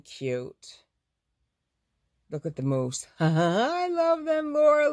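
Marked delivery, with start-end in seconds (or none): 3.2-4.9 high, slow gushing delight; squealing with delight at the gnomes. Real, not acted. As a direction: coo over how cute they are. Gushing over cute gnome fabric.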